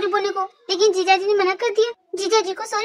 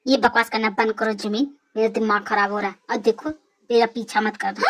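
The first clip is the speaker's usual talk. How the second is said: नीचे सुर में खीझी चेतावनी; तंग आकर बात ख़त्म करो; दबा हुआ गुस्सा; 8 semitones lower than usual. Acted. लड़का तंग आकर बात काटता है. (दबी झुँझलाहट)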